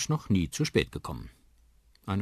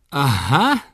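loud, higher exclamation of realisation; calling out; 8 semitones higher, 12 dB louder than usual. Acted. Papa reacts to the uncle's news, loud knowing exclamation. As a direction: exclaim it as if you knew it. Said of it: "ruft Papa".

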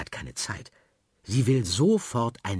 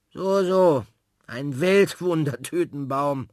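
slow, louder, teasing echo; mock doubtful; 6 semitones higher, 5 dB louder, slower than usual. Frau Klotz repeats the word, teasing and slow. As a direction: humour the child without believing.

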